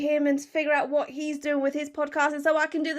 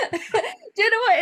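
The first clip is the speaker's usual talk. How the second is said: words spilling out of a laugh; amused, giggling lead-in; 9 semitones higher, 5 dB louder than usual. Real, not acted. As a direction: laugh through the question. Amused by the accent compliment.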